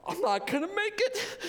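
very high laughing mock cry; fake-sob the line for laughs; mock wailing despair.

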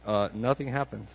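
low, soft, unbothered dismissal; mock couch-bound indifference; unbothered husband. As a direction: brush it off from the couch. Acted unbothered man on couch.

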